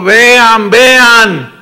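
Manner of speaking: ringing repeated imperative; loud command. Acted. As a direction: urge them loudly to look. Preacher voicing the Lord's command.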